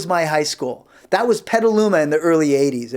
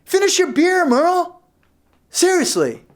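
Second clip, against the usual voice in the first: much higher, exasperated nagging; exasperated scolding; 11 semitones higher, slower than usual. Retold or imitated. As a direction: scold a friend about his beer. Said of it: "I'm like".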